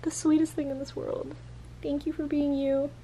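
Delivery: doting on the cat. Real, not acted. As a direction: melt over the cat.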